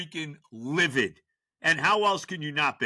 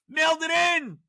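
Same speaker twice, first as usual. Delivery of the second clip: higher, louder, disgusted shout; angry shout; 9 semitones higher, 3 dB louder than usual. Real, not acted. Ranting host shouts about a lazy player, furious. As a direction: spit it out in outrage.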